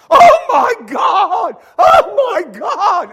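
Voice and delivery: high, very loud, slow shrieks; crowd screaming. Retold or imitated. Crowd sees the new house, shrieking. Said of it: "they go".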